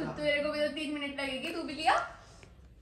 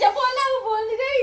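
crying shout; 7 semitones higher, 9 dB louder than usual. Retold or imitated. Retelling sister-in-law's outburst.

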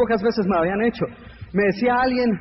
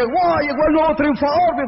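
hammed-up higher cheering; mock the cheerleaders who won't greet you, voiced higher; 6 semitones higher than usual. Speaker mocking cheerleaders welcoming the winner home, higher hammed-up cheer.

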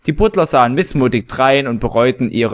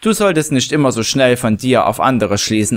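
plain reading voice

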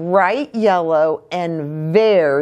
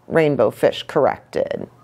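usual speaking voice

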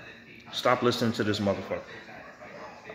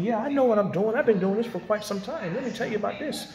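mocking know-it-all voice; 10 semitones higher than usual. Vlogger mocking infiltrators who claim expertise, high smug voice. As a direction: sneer it up high like a fake expert. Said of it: "these motherfuckers coming in".

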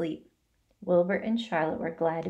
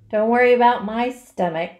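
a mild snarl, louder and rough; half-hearted snarl of a fed-up rat; 3 semitones higher, 7 dB louder than usual. A narrator's reading. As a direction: snap back at the spider. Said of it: "snarled Templeton".

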